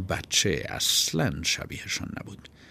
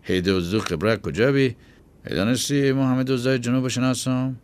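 mocking; 5 semitones higher, 3 dB louder than usual. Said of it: "به مسخره گفت".

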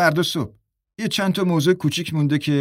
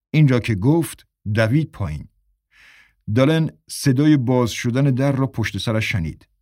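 ordinary delivery

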